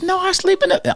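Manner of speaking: playing the little girl answering back; lightly voiced young girl; girl's giggly answer. Girl answering his silly questions.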